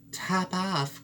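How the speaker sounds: a small mouse crying the odd name; cried out. Acted. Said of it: "cried the mouse".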